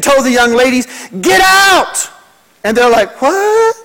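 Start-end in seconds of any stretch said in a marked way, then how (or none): 1.2-2.1 urgent shout. Retold or imitated. Warning girls about a shark. 3.2-3.8 put-on high, puzzled teenage "What?"; mock teenage girl. Girls answering the pastor.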